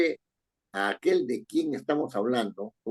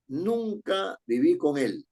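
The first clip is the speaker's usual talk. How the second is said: Nunca viví con él, slow and even; slow deliberate reading; 5 semitones higher, slower than usual. Shown as a demonstration. Teacher reading the example sentence aloud for the class, slowly. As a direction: read it out slowly, word by word. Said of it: "Te lo anuncio".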